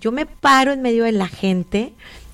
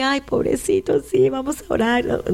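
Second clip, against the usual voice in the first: mock weepy pity; 4 semitones higher than usual. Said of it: "como que llorar".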